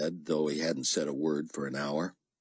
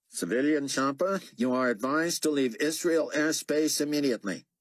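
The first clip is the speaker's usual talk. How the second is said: higher, clipped official radio voice; an officer issuing orders over the radio; stern radio officer; 10 semitones higher than usual. Acted. Radio transmission from GC.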